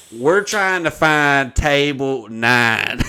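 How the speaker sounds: loud, slow, drawn-out holler; calling out. Retold or imitated. Latecomer interrupting a speech to find his seat, loud holler. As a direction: holler it across a crowded room. Shouting across room during speech.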